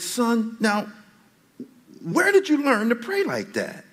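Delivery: high, startled dad question; father asking. Retold or imitated. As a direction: act the shocked father. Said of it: "And I said".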